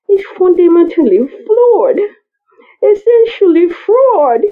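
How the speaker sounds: lightly voiced woman scientist. Scientist confessing the flaw to her colleague, lightly voiced and tearful. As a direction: explain the failure, rising toward tears. Woman explains the failure at length.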